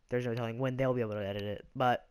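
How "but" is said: usual speaking voice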